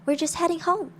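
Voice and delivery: put-on high, chirpy young boy; kid's voice. A narrator's reading. Kid answers stranger in story.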